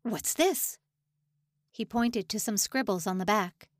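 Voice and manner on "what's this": higher-pitched, fake shocked question; mock alarm. A narrator's reading. Officer pretending shock at the prisoner's letter. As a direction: play at being scandalised. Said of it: "let his jaw drop open in mock alarm".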